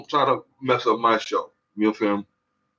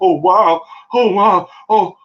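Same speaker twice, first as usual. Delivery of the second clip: much higher, louder oh wow; mock amazement; 9 semitones higher, 5 dB louder, slower than usual. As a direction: play the stunned onlookers. People amazed when kids recover.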